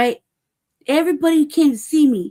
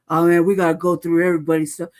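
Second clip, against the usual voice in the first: lower, mock-official guard voice; a light take-off of a bored guard; imitating the guard, understated; mimicking security guard; 6 semitones lower, faster than usual.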